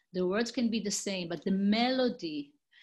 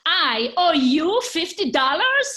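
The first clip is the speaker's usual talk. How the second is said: loud, slow, much higher outraged question; upset disbelief; 8 semitones higher, 11 dB louder, slower than usual. Acted. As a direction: drag each word out in loud indignant shock. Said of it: "Don't get too upset".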